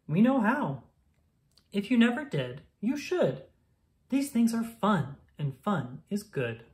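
ordinary delivery

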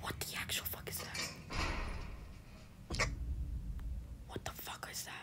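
voiceless, repeated whisper of disbelief; alarmed whisper. Real, not acted. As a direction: whisper it in alarm, trying to stay unheard. Whispering at a threat in game.

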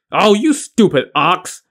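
high, slow exasperated insult; scornful scolding. A narrator's reading. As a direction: draw out the insult with contempt. Li Kui scolds the wailing squire.

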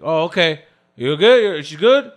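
husband asking loudly if she's fine. Retold or imitated. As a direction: call out like a wary husband. Husband's loud questions.

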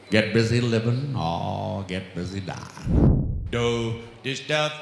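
Morgan Freeman impression. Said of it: "Morgan Freeman".

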